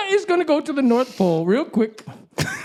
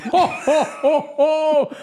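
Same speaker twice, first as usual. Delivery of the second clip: Santa laugh; 3 semitones higher, much slower than usual. Acted.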